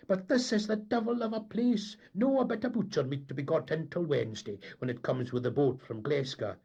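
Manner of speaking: high, loud, cheery excuses; affable Scots tar. A narrator's reading. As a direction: sell the excuse with a grin. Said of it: "he would say affably".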